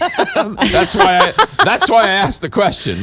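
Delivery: laughing through the words; chuckling while speaking. Real, not acted. Laughing at own question.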